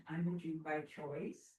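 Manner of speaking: ordinary talk, nothing marked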